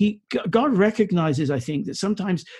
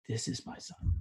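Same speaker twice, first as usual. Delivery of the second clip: breathy whisper, softer still; whispered awe; 7 semitones lower, 14 dB quieter than usual. Acted. Preacher repeating God's words to wondering listeners, whispered. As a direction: let it fall to a reverent whisper.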